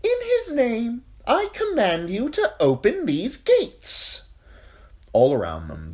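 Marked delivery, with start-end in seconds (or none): 0.0-4.2 high, slow, commanding knight; high thin voice. A narrator's reading. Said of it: "He had a high, thin voice".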